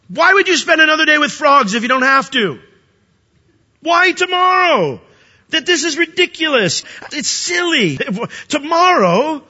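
high, loud disbelief; can't believe the absurdity; incredulous. Real, not acted. Preacher scoffing at Pharaoh's choice, high and loud.

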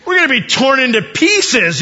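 high, loud mock panic; panicked outcry. Brothers realizing who Joseph is, high and panicked. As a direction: cry out as if doomed.

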